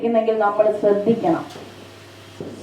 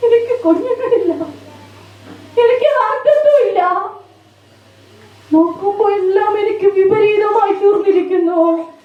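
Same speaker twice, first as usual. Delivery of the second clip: put-on higher, pleading lament of a childless woman; Hannah lamenting; 7 semitones higher than usual. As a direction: voice Hannah's grief, pitched up. Hannah's lament voiced by preacher.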